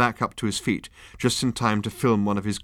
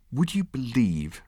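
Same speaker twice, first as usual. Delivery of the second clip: exasperated; 3 semitones higher, slower than usual. A narrator's reading. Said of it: "said Ford in exasperation".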